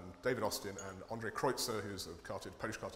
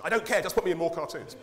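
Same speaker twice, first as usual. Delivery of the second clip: loud, pushy politician brushing it off; eager insistence; 6 semitones higher, 9 dB louder than usual. Retold or imitated. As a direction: brush it aside, loud and keen. MP's phone call retold.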